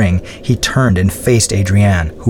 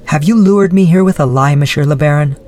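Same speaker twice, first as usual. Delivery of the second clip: put-on higher young woman's voice, wary; Adrienne, suspicious; 6 semitones higher than usual. Adrienne confronting the Baron, higher and accusing. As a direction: ask her accusingly in a lighter voice. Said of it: "she spoke".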